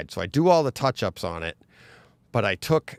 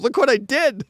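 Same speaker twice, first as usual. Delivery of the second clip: sheepish; 7 dB louder than usual. Retold or imitated. Client texting about crushed bike.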